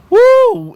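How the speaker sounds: a loud, very high "woo" at the price; woo of shock. Real, not acted. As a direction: whoop out loud and high in disbelief. Reacting to Garden ticket prices.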